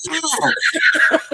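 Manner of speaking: retold hammer-on-thumb mishap, high laughing yelp; mock yelp of pain. Hitting own thumb retold.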